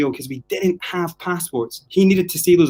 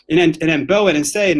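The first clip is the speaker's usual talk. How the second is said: ordinary talk, nothing marked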